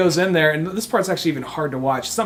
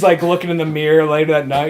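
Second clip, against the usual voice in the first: ordinary talk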